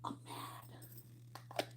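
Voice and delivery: a muttered whisper; whispered fish voice. Retold or imitated. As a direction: hush it as the sulky fish. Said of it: "He's like".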